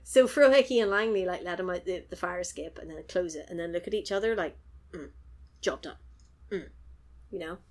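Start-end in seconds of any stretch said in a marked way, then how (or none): 4.9-6.7 understated deadpan, a touch low; mock-solemn nod of a job finished; all serious. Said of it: "All serious face".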